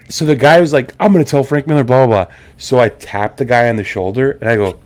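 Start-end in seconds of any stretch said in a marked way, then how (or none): 1.0-2.3 louder, higher mock threat; mock an irate customer's empty threat; imitated freak-out. Retelling customer threatening booth owner, mocking freak-out. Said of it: "this guy is still freaking out".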